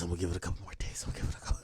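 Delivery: fast, hushed whisper. Retold or imitated. To himself about holding back criticism, a fast whisper. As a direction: whisper it to yourself like a secret. Hushed repeat of his own stalling.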